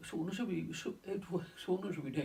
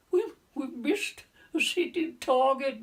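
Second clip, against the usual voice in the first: loud, high retold cry of dismay; dismayed exclamation; 9 semitones higher, 8 dB louder than usual. Sailor watching torpedoes seem to miss, dismayed outcry.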